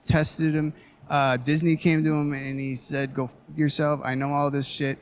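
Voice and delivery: ordinary talk, nothing marked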